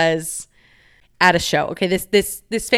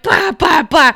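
mock drunk babble; 7 semitones higher, 5 dB louder, slower than usual. Mocking own drunk rambling.

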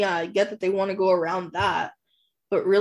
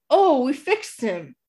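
joking flippantly; 6 semitones higher, 3 dB louder, slower than usual. Retold or imitated. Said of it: "kinda joking around".

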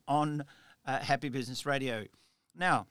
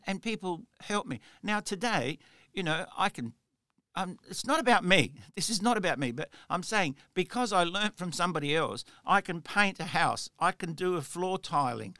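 ordinary delivery

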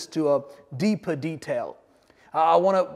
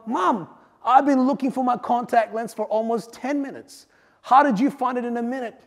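put-on young boy; 6 semitones higher than usual. Boy voiced asking his mom.

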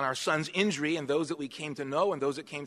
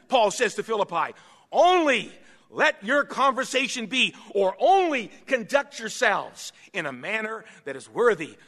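emphatic, raised pulpit delivery; forceful preaching; 6 semitones higher, 7 dB louder than usual. Real, not acted. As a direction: punch out the key words as you preach.

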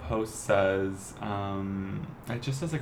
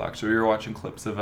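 ordinary talk, nothing marked

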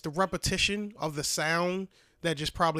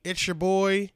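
ordinary talk, nothing marked

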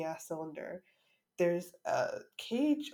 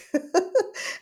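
bright laugh breaking out loud; high burst of laughter; 14 semitones higher, 10 dB louder than usual. Real, not acted. Laughing at the cage surprise.

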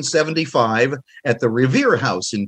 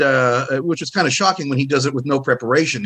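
usual speaking voice

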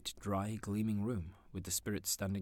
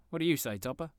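jovial call; 8 semitones higher, 6 dB louder than usual. Acted. Nephew calls to friend.

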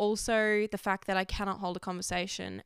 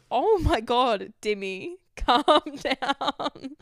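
loud, amused scolding through laughter; real laughing exclamation; 4 semitones higher, 6 dB louder, much slower than usual. Real, not acted. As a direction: laugh while calling out to calm someone down. Laughing at Dimmy jumping around.

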